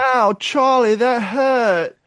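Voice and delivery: put-on high British child voice; imitating a little British boy; British accent. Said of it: "I'm still doing the British accent".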